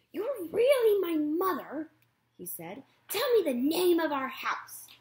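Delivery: put-on high, loud, doubtful young voice; suspicious gosling. Gosling demands proof from the robot mother. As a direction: test her with each question.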